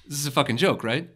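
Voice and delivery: ordinary delivery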